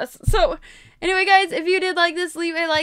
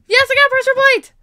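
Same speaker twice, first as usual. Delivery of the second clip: high, loud burst of relief; thrilled, raised exclamation; excited triumph; 10 semitones higher, 9 dB louder than usual. Real, not acted. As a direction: shout it out in victory. Wins item in boss fight.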